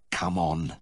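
ordinary delivery

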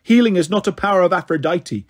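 ordinary delivery, nothing marked

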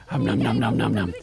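low-pitched gobbling noises; mock munching. Player feeding the horsey, low mock munching. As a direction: chomp through the noms, deep. Voices horse or Titan eating.

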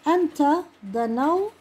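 أنت دنوت said slowly and drawn out; a teacher dictating to students; slow dictation. Shown as a demonstration. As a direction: sound out دنوت for the class. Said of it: "بنحط الفتحة".

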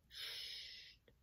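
very soft hushing hiss; barely audible "sst"; whispered shush. A narrator's reading. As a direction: shush someone in secret. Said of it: "fluisterde hij".

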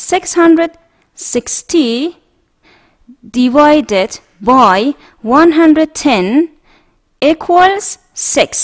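slow, clear dictation pace; slow model reading. Shown as a demonstration. Teacher reading a subtraction problem aloud for students to repeat, slow. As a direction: spell out each number slowly.

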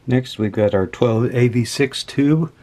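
ordinary talk

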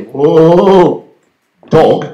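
startled "whoa"; alarmed warning call; alarmed forecaster. Retold or imitated. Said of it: "if they say".

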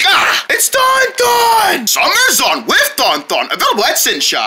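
excited ad tagline; toy commercial. Acted. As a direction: announce the toy with hype.

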